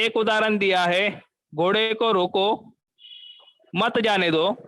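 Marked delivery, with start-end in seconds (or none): 1.5-4.6 घोड़े को रोको, then a clear pause before मत जाने दो; pause after रोको. Shown as a demonstration. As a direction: break after रोको, keep मत जाने दो together. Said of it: "वाक्य में बदल हो जाता है".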